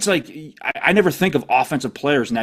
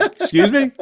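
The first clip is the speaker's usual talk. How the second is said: shocked high; 11 semitones higher than usual. Pretend.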